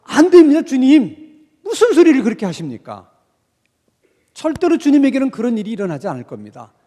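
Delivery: loud, forceful pleading disciple; strong protest. Retold or imitated. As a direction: push back hard against the master. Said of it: "아주 그냥 강한 어조로".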